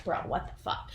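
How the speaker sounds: whispered in disbelief; mock whisper of disbelief; stunned whisper. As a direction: breathe it out, shocked. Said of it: "they're all like".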